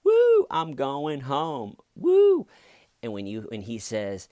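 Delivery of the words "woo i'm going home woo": slow and smug, then a whooping cheer much higher and louder; smug confidence. Retold or imitated. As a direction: gloat like you're already free, ending in a big woo. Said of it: "from this confident".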